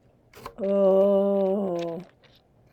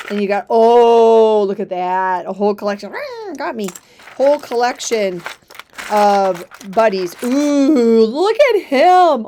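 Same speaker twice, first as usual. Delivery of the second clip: excited; 9 dB louder than usual. Real, not acted. Excited over collection.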